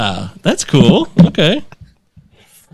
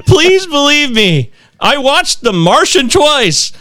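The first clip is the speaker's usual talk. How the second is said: mock pleading; 10 semitones higher, slower than usual. Acting out listener's pleading email.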